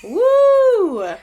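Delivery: high, loud whoop of joy; excited cheer. Real, not acted. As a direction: cheer it out big. Cheering the episode count.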